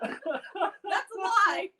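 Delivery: high, laughing through the words. Real, not acted. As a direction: crack up while talking. Laughing at grandpa bit.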